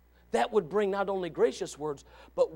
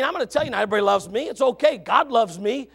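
normal talking voice

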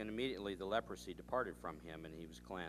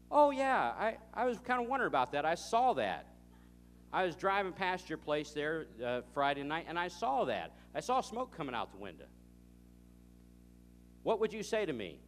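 much higher and louder, falsely casual; mock offhand reply; 7 semitones higher, 7 dB louder than usual. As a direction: act like it was no big deal, ironically.

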